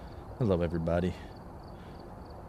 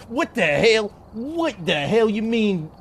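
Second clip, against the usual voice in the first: indignant; 9 semitones higher, slower than usual. Acted. Arguing with fake lawyer.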